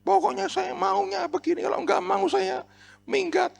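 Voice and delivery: very high mock-petulant voice; squeaky put-on ultimatum; stubborn person's voice. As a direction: imitate someone demanding their own way. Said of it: "Ada orang-orang yang begitu".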